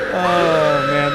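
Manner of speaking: big real laugh into a loud oh. Real, not acted. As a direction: burst out laughing.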